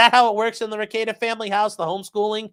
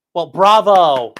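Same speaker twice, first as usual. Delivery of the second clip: sarcastic bravo; 2 semitones lower, 8 dB louder, much slower than usual. Real, not acted. Sarcastic applause for the bit.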